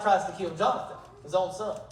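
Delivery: normal talking voice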